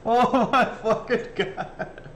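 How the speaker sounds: high, slow, through laughter; laughing. Real, not acted. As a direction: gasp it out while laughing. Laughing at the clip.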